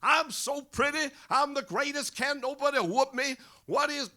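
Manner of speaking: very high, loud boxing bombast; high-pitched brag, hammered out loud; Muhammad Ali. Acted. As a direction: crow it like a boastful champion. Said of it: "he starts in with the Ali bombast".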